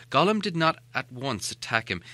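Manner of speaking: ordinary delivery, nothing marked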